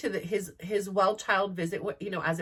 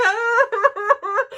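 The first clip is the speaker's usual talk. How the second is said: high, loud fake wail cut with laughter; a mock cry to make the crying kids stop; fake dramatic cry; 14 semitones higher, 9 dB louder than usual. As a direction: ham up a pretend sob. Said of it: "do this big dramatic cry".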